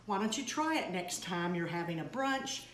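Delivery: usual speaking voice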